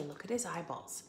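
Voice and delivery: ordinary delivery, nothing marked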